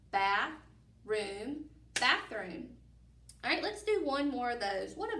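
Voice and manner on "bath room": Bath, room as two separate words with a pause; split into parts. Shown as a demonstration. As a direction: say each part apart. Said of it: "let's do that again".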